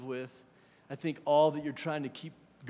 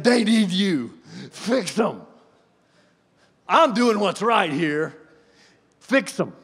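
mock bossy prayer; 4 semitones higher, 12 dB louder, slower than usual.

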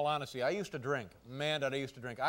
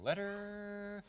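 fruity announcer voice; 4 semitones higher, 7 dB quieter, slower than usual. Acted. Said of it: "don't make me sound quite so fruity".

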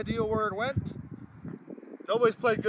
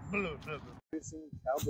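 frustrated blah; 5 semitones lower, 6 dB quieter, much slower than usual. Real, not acted. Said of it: "blah, start again".

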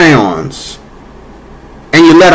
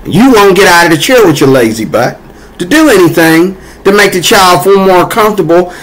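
usual speaking voice

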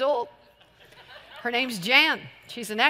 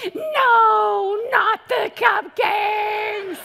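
much higher, slow, wailing mock cry; mock demon wail; 9 semitones higher, 4 dB louder, much slower than usual. The demon of exploitation losing, a hammed-up high wail. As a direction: ham up a defeated demon. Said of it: "demon power ... go".

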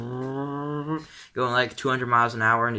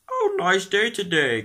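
higher, slow put-on cow voice; 7 semitones higher, 4 dB louder, slower than usual. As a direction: play a cheery cow greeting.

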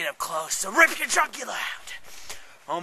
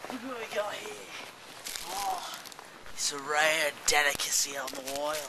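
usual speaking voice

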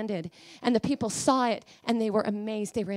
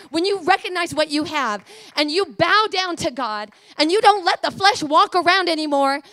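loud, urgent exhortation; full-force preaching; 5 semitones higher, 8 dB louder than usual. Real, not acted. Preacher urging believers to put the flesh on the altar, loud and urgent. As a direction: drive every phrase at the congregation.

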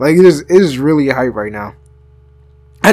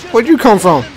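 startled; 6 semitones higher than usual. Real, not acted. Startled by enemy appearing.

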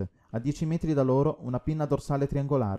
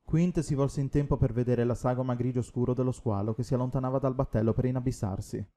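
ordinary delivery, nothing marked

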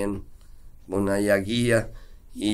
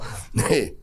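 short laugh. Real, not acted. Laughs after his punchline.

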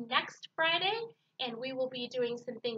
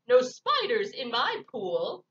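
croaking frog; 2 semitones lower, 7 dB louder, slower than usual. Acted. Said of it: "croaked the frog".

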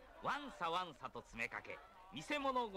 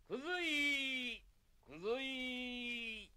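drawn-out peddler's cry; street vendor call; 8 semitones higher, much slower than usual. Acted. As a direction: sing out the junk call slowly. Junk dealer's street call.

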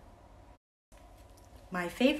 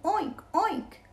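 cats oinking; 11 semitones higher, 4 dB louder, much faster than usual. Acted. Cats oink in story.

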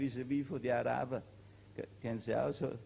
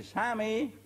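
higher and a bit stronger call of Sami; louder shout; 8 semitones higher than usual. Acted. Told to shout louder, soldier calls across, stronger shout. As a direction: push the call out harder. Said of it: "Schrei ein wenig lauter".

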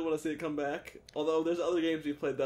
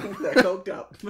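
laughing; 3 semitones higher, 10 dB louder, slower than usual. Real, not acted. Laughing repeat of joke.

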